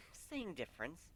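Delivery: breezy dismissive mare; airy. A narrator's reading. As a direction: wave it off lightly. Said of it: "with an airy wave of a hoof".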